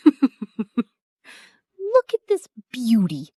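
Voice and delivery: slow, laughing through the words; giggling as you say it. Real, not acted. Laughing at sister's face pack.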